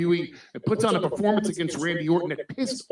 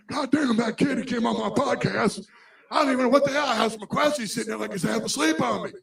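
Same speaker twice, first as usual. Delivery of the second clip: high, fast, griping wrestler impression; put-on grumbling wrestler's voice, pitched up; Steve Austin; 5 semitones higher, faster than usual. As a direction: rattle it off as an exasperated wrestling star. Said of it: "his Steve Austin impression's better than mine".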